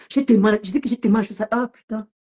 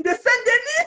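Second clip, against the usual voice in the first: laughing; 14 semitones higher, slower than usual. Real, not acted. Laughing at rival's name.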